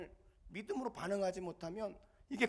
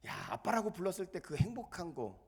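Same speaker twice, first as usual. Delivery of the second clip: ordinary talk, nothing marked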